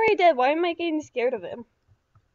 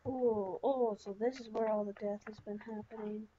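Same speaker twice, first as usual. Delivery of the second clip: ordinary talk, nothing marked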